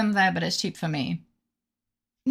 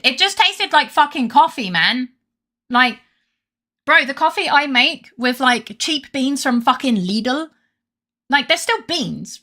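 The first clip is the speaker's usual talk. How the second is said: louder, higher exasperated ranting about cheap beans; heated rant; 7 semitones higher, 5 dB louder than usual. Real, not acted. Streamer ranting to chat about overpriced coffee, exasperated and raised. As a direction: vent it, raised and fed up. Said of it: "I'm going on a rant about coffee".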